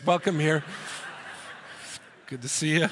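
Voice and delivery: ordinary talk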